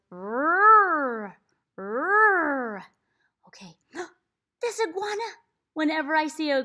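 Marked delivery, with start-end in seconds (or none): 0.1-2.8 loud rolling "Rrr" engine rev; revving motorcycle. Shown as a demonstration. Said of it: "it goes".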